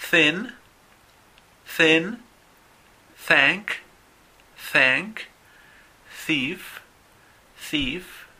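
"Thin" and "thank" said with a clear breathy th, the tongue between the teeth; just blow air for the th in "thief", no voice; voiceless th drill. Shown as a demonstration. Teacher models a voiceless th for Spanish speakers to repeat, slow and clear. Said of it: "Repitan después de mí".

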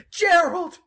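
high, loud sobbing cry of relief; joyful crying. Acted. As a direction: weep his name with joy. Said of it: "he's just crying".

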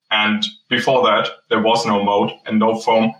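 ordinary delivery

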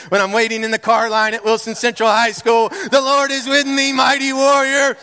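a pastor building a fervent refrain over music; preaching at full force. Acted. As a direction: lift the room with rising declarations.

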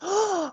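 sharp high delighted gasp; excited gasp. Pretend. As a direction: gasp with sudden glee. Excited by becoming smaller.

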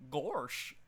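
dopey cartoon dog voice; Goofy voice.

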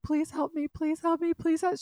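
high, repeated frantic plea; imitated tearful begging. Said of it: "She is still crying".

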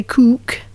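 COOK said with a long Geordie oo, as "kook"; Geordie vowel. Shown as a demonstration. Actor demonstrating the coach's practice word, Geordie oo in COOK. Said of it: "need to turn Geordie".

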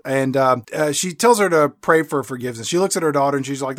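ordinary talk

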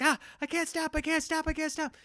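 dad mimics his son rolling downhill in mock panic; panicking kid. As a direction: squeal it out like a kid who can't stop. Son rolling downhill, can't brake.

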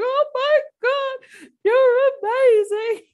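high, loud, drawn-out wail; mock bawling. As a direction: overdo proud tears, stretching each word. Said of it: "I'm bawling going".

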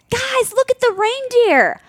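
high-pitched, over-eager dad exclamation; mock excited husband. Wife mimics husband at the zoo, high excitement. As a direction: imitate a dad thrilled by his find.

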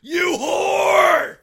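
high, loud mock outrage; shouted insult. [name] throwing it back at her bully, shouted insult. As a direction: yell it as a drawn-out, over-the-top accusation.

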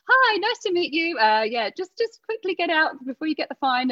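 high, overbright embarrassed politeness; smooth over the awkwardness brightly; awkward cheery greeting. Retold or imitated. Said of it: "And I was going".

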